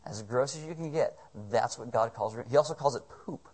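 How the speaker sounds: ordinary delivery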